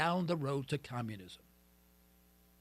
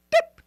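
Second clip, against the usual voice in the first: sudden high, loud cartoon boop; high squeaky boop; 10 dB louder than usual. Host making a glitch noise, high squeaky boop. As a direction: pop out a tiny high boop sound. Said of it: "'Cause I".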